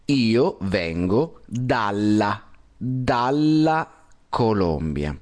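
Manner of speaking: io vengo dalla said slowly, stressing the double l in dalla; slow dalla with long l; correct dalla. Shown as a demonstration. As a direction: model the right phrase slowly. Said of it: "Se dice".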